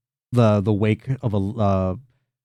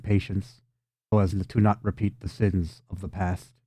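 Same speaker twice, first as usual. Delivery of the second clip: low, softer, measured pronouncement; quiet, lowered voice of an ancient spirit; solemn water elemental; 4 semitones lower, 6 dB quieter than usual. Acted. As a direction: pronounce it gravely, with long pauses. Game master voices water elemental.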